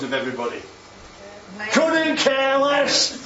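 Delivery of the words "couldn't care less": high, slow and loud, joyfully unbothered; carefree healed woman. Acted. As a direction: sing it out like she doesn't care who's watching. Voicing the healed woman's joy.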